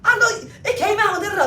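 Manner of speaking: high, loud whiny fake-innocent voice; mock innocent Brianna. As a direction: play dumb in a high, pleading whine. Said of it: "acting like she don't know".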